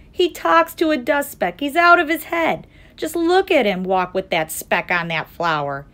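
high, loud jeering gossip; mocking gossip. A narrator's reading. Jungle gossip mocking the elephant.

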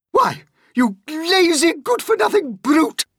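very high, loud furious aunt; Aunt Spiker shouting. Acted. Aunt Spiker shouting at James, high and loud. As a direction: shout the insult at the boy. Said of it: "Aunt Spiker shouted".

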